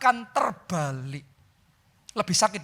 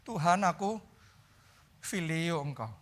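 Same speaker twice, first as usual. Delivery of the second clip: much softer, falling admission; humble reply; 3 semitones lower, 7 dB quieter than usual. Retold or imitated. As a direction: say it low and ashamed. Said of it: "Simon tetap ngomongnya".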